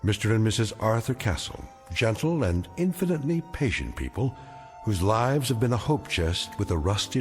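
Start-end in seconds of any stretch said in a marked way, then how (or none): none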